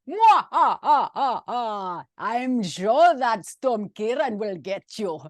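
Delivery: high, hammed-up Russian villain with evil laugh; cackle, then threaten slowly in a mock Russian accent. Parent's bedtime-story villain warning about the storm, evil Russian accent. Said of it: "He comes out Russian".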